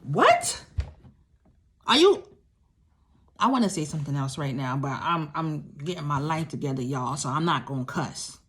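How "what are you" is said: high, loud disbelieving what; shocked. Real, not acted. To the audience, reliving husband's words, loud shock. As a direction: gasp out a stunned what.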